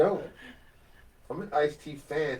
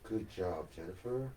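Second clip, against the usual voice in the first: light impression, lower and softer; gently lowered voice of an encouraging painter; Bob Ross; 4 semitones lower, 6 dB quieter than usual. Pretending to cheer on a student painter, mellow impression. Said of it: "start practicing his voice".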